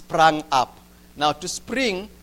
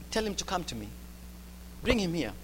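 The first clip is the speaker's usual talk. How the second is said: soft command; 3 semitones lower, 12 dB quieter than usual. Acted. Jesus calmly summoning the blind man.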